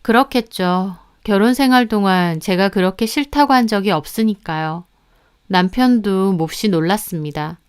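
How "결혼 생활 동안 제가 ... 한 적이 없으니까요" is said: ordinary delivery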